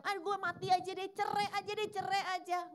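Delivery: a mock dramatic wail about dying and divorce; hammed-up moaning of a careless talker; careless despairing talk. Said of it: "Ngomong yang".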